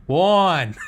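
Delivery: "Warren" as one loud, high "Wawn"; one-syllable Warren. Acted. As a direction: belt "Warren" out as a single swooping syllable. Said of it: "one syllable".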